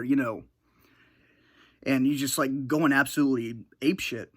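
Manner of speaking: ordinary talk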